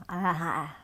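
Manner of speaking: ah with the tongue stretched out long; tongue sticking out. Shown as a demonstration. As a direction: say it with your tongue out. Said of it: "I'm gonna show you".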